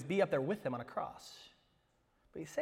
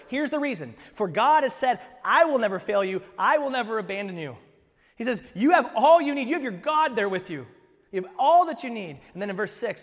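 loud, raised, emphatic proclamation; impassioned preaching; 7 semitones higher, 10 dB louder than usual. Real, not acted. Preacher proclaiming God's promise to the congregation, forceful raised voice. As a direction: drive each promise home at full voice.

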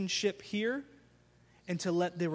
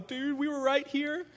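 mock protest; 8 semitones higher than usual. Disciples protesting to doubting Thomas.

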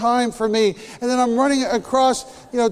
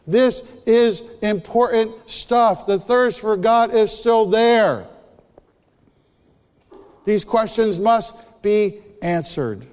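slow emphatic; slower than usual. Real, not acted. Emphatic sermon point.

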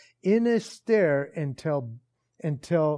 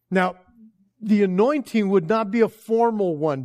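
rising, loud pulpit preaching, pressing each phrase; preaching at full force; 4 semitones higher, 6 dB louder than usual. Acted. Pastor preaching to the congregation, loud and building. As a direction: build it up like a preacher driving the point home.

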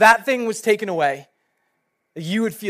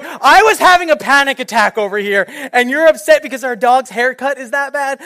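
loud, high, hammed-up exasperation; exasperated outburst; 5 semitones higher, 7 dB louder than usual. Husband answering wife's false emergency, loudly exasperated. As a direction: retell it as an indignant, raised-voice protest. Acted exasperated reply to wife.

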